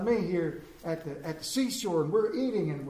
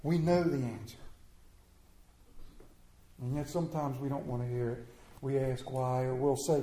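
usual speaking voice

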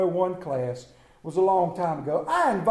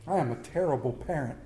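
quiet laughing admission; 8 semitones lower, 6 dB quieter than usual. Real, not acted. Preacher owning up to the congregation, soft and laughing. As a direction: lower and softer, chuckling through it. Repeated confession after story.

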